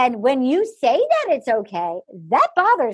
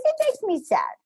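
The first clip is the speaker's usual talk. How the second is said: higher, whiny fake sadness; half-hearted imitation of sadness, pitched up; mock sad Juan Pablo; 9 semitones higher than usual. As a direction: ham up a hollow it makes me sad. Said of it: "And he goes".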